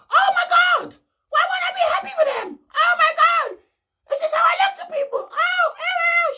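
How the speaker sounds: very high shrieking laugh-cry, shouted; hyena laugh. Retold or imitated. Mimicking Belle shocked over being called fake, laughing hyena shriek. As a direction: squeal it out like a cackling hyena. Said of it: "sounding like a hyena out there".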